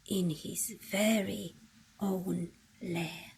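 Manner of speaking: soft hush for a sleeping bear; softly, bedtime hush. A narrator's reading. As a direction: drop to a hush, as if not to wake him. Bear asleep in lair.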